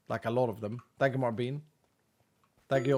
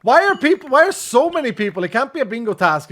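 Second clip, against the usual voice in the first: much higher, louder disbelief; astonished outburst; 9 semitones higher, 10 dB louder than usual. Real, not acted. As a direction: exclaim in real surprise. Surprised by chat requests.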